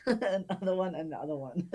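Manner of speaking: words through laughter; laughing. Real, not acted. Repeating while laughing.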